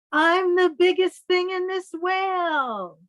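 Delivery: high, proud squid voice; squid still boasting. Acted. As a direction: brag undaunted inside the whale. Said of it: "And you know what it says?".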